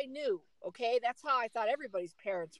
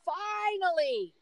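drawn-out finally; 7 semitones higher, much slower than usual. Real, not acted. Waiting twenty minutes for dad.

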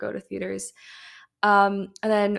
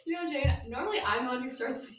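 laughing; 4 semitones higher, 4 dB quieter, faster than usual. Real, not acted. Host interrupted by guest starting.

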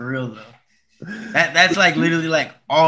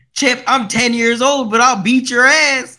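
put-on high, loud, slow threatening kid; mock grandson; 8 semitones higher, 4 dB louder than usual. Co-host quotes the grandson threatening Chip, high mock kid voice. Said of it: "the grandson was like".

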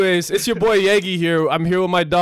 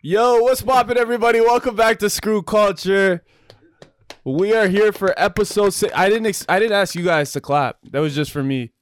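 hype host intro; 4 semitones higher than usual. Acted. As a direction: greet the crowd like a hyped show host. Podcast host opening hype.

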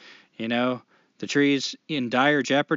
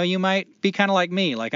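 ordinary talk, nothing marked

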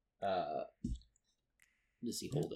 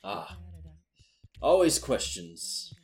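pompous villain; 3 semitones higher than usual. Acted. Mage hunter introduces himself.

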